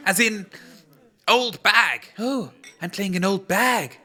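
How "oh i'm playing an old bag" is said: ordinary talk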